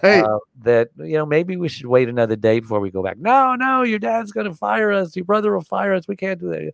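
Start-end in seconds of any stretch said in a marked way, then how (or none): 3.2-6.7 voicing the crew high and pleading; panicky crew. Crew begging the captain to sail now, high pleading voice. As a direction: plead like scared employees afraid of being fired.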